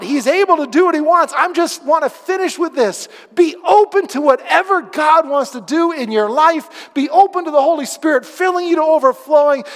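loud, high, fervent sermon climax; preaching at full force. Acted. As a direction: drive the closing appeal home with fire.

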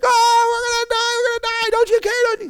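high, loud mock panic; hammed-up panicked shouting; freaking out. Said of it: "they're freaking out".

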